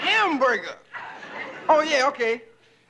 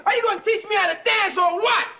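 yelling; 7 semitones higher, faster than usual. Acted. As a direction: shout it at her, fed up. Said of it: "Don't yell at me like that".

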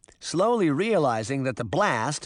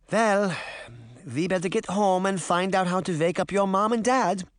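put-on higher-pitched accented old woman; granny getting everyone moving; Granny figure, brisk and practical; 4 semitones higher than usual. Voices Granny in the story.

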